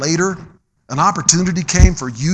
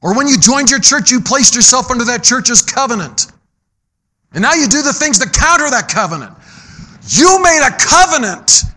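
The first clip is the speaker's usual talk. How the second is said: higher, louder, driving sermon voice; fervent preaching; 8 semitones higher, 5 dB louder than usual. Acted. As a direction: build the covenant point to a loud peak. Preaching at full force.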